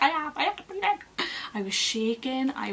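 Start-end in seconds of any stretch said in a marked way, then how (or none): 0.0-1.0 high-pitched, indignant insistence; frantic protest. Retold or imitated. Patient answering midwife about breathing, frantic protest.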